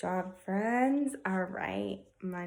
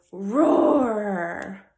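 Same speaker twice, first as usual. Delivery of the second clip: loud growling roar; tiger roar; 10 dB louder than usual. Shown as a demonstration. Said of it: "Can you give me your best roar?".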